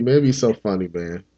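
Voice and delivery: ordinary talk, nothing marked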